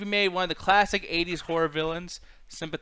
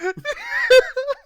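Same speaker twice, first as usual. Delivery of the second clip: loud, high, real laugh bursting out; a sudden loud cackle; big burst of laughter; 10 dB louder than usual. Real, not acted. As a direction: crack up at being set up. Reacting to being set up.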